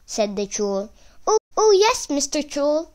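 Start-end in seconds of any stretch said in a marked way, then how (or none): 1.2-2.9 very high, excited squeak; eager little goat. Acted. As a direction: answer the troll high and eagerly. Little goat answers the troll eagerly.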